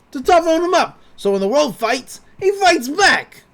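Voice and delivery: high, loud, emphatic tough-guy voice; tough old man. Retold or imitated. Retelling Stan explaining why he is hard on Dipper, mock tough. Voicing Stan's tough speech.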